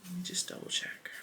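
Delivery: soft unvoiced whisper; whispered note while checking the box; whisper to herself. Real, not acted. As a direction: breathe it as a private aside.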